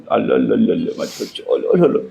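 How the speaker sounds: put-on playful baby cooing; mock cooing. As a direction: coo at her like a baby, teasing. (लाडाने बाळाशी बोलल्यासारखं)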